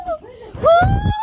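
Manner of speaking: high loud frightened yelps; jolted by the strike, screams; startled scream. Real, not acted. Strike caught on camera.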